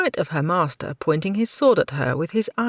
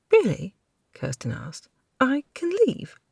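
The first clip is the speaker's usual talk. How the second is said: higher, brighter hopeful question; eager surprise; 9 semitones higher than usual. Acted. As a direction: perk up at the chance to leave. Kirsten eager.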